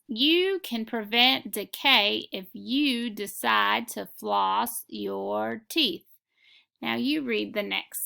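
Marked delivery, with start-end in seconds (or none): 0.1-6.0 slow dictation reading. Shown as a demonstration. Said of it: "I'm going to read the next sentence".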